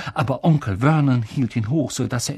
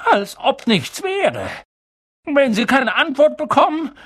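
high, strained insistence; agitated resolve; 11 semitones higher, 3 dB louder than usual. A narrator's reading. Uncle tells his wife they will ignore it, agitated. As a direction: insist high, working yourself up.

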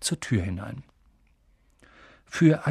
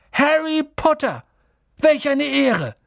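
high, loud, drawn-out exclamation; slow, soaring cry of reverence; awestruck; 12 semitones higher, 5 dB louder, slower than usual. A narrator's reading. Old barman recognising the famous Harry, awestruck.

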